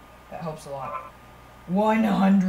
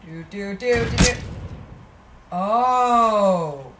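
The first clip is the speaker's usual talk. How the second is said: ordinary talk, nothing marked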